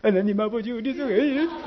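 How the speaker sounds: quiet, earnest prayer voice; soft prayer tone. Shown as a demonstration. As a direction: pray softly for the child. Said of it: "기도 톤이 있어요".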